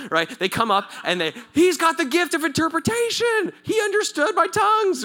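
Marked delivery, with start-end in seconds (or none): 1.5-5.0 high, loud mock announcing; excited proclamation. Korean man telling all the people, excited high proclamation. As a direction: shout the amazing news to everyone.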